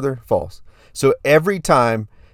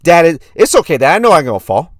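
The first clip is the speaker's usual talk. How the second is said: put-on loud, earnest little-kid voice; impression of a young son reassuring Dad; four-year-old son; 4 semitones higher, 5 dB louder than usual. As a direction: insist like a plucky small boy. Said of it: "do my best impression of my son".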